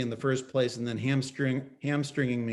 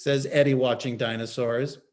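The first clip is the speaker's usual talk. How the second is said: plain reading voice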